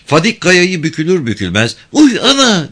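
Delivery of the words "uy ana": put-on high-pitched girl's yelp, a little louder; small, high exclamation of alarm; light startled cry. A narrator's reading. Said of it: "diye hafiften bağırdı".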